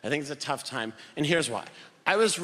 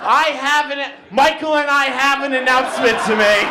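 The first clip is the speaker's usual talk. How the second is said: raised, loud, formal announcing voice; mock formal announcement; 9 semitones higher, 10 dB louder than usual. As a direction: announce it grandly to the family table. Formal announcement at family dinner.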